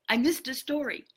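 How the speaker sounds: whispered, fast confession; dramatic whisper. Real, not acted. Speaker confessing to audience, dramatic whisper. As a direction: let them in on a secret.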